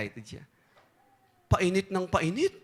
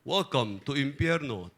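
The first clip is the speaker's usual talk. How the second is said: demon greeting; 7 semitones lower, slower than usual. Acted. Demon greets politician in hell.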